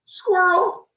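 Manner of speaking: high, loud sudden cry; mock distracted outburst.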